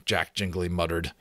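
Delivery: usual speaking voice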